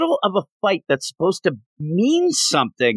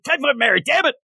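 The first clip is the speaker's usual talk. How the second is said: a mock boss snapping at his underling; mock scolding shout; 8 semitones higher than usual. Fisk scolds Typhoid Mary.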